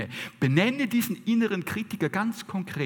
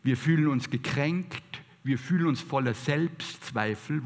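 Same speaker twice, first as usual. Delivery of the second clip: normal talking voice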